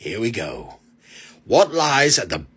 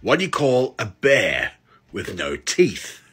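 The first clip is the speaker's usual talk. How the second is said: normal talking voice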